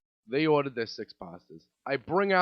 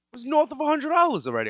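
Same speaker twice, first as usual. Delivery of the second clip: higher, louder, fast defiant burst; defiant rush; 12 semitones higher, 5 dB louder, faster than usual. Real, not acted. Waiter justifying himself, fast defiant burst. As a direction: rattle it off, defiant. Venting excitedly.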